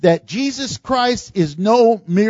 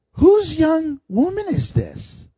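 slow, higher, measured question from the master; Boaz asking; 8 semitones higher than usual. Retold or imitated. Boaz asks his foreman about the gleaner, slow and dignified.

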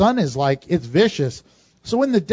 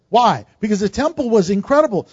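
ordinary talk

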